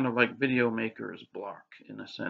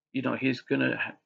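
ordinary talk, nothing marked

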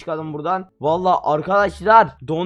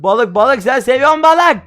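frantic coaxing; 7 semitones higher, 9 dB louder than usual. Real, not acted. Coaxing dragon with fish.

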